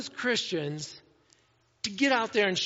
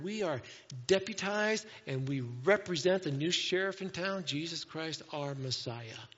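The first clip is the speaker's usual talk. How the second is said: ordinary delivery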